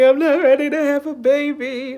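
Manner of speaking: loud, high mock wail; hammed-up tearful cry, high and loud; imitated crying. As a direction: wail it out, retelling your own panic. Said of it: "you know, I'm crying".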